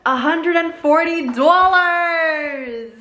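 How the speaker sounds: slow, high, drawn-out announcement; big reveal. Real, not acted. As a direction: build it up like a drumroll reveal. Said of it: "Okay, drum roll, please".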